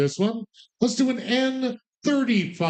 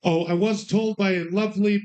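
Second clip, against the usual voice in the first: ordinary talk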